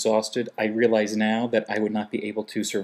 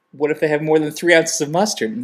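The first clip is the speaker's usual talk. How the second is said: pushy trainee; 6 semitones higher, 7 dB louder than usual. Retold or imitated. Trainee pressing the instructor.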